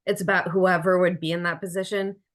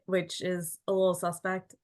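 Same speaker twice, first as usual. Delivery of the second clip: ordinary talk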